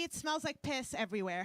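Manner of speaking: normal talking voice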